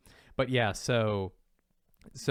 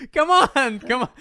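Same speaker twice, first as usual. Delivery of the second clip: much higher, louder sing-song; gleeful taunt; 13 semitones higher, 11 dB louder than usual. Retold or imitated. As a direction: goad him like a playful grandpa. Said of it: "He's like".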